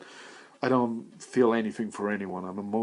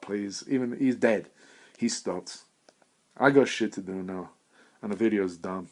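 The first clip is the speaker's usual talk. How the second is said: ordinary talk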